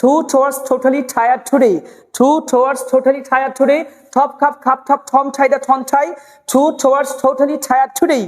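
"totally tired today" drilled with airy T's; even, rhythmic "To towards" drill with aspirated T. Shown as a demonstration. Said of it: "এইভাবে পড়তে হবে".

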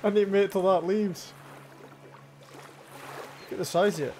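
rushed nervous urgency; scared horror game streamer. Real, not acted. Still fleeing the creature.

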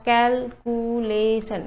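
Calculation slowly split into four syllables. Shown as a demonstration. Teacher repeating the breakdown for students, calculation in slow separate beats. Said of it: "এইটো চাৰিটা শ্লেপ".

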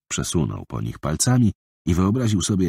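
ordinary delivery, nothing marked

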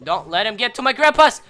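high, loud desperate plea; panicked shout. Real, not acted. Gamer screaming at his game to protect a unit.

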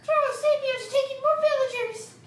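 high desperate yell; shouting for help. Acted. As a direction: cry out for help. Character yelling for help.

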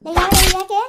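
high, loud startled yell; startled shout. Acted. Woman woken up yelling who and why, high and loud. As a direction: jolt awake and shout.

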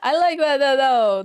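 amused. Real, not acted. Laughing at pickup line.

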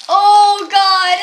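high, loud disgusted yell; disgusted shout. Real, not acted. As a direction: recoil from a foul smell. Disgusting licorice smell.

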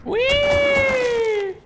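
gleeful whee. Real, not acted. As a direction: shout with glee. Zooming across map.

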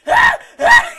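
piercing, very loud high scream of fright; startled scream. Retold or imitated. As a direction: scream like someone just woke you with a jolt. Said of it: "you're like".

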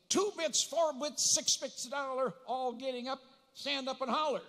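loud, high rallying cheer-chant; cheery wake-up holler. Retold or imitated. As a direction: holler a cheer to rouse sleeping kids. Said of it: "wake up to a cheery thought".

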